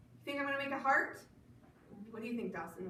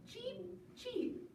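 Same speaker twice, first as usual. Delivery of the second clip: put-on high baby-bird chirps; light, high cheeps; little yellow chick; 8 semitones higher, 7 dB quieter, much slower than usual. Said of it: "said the little chick yellow".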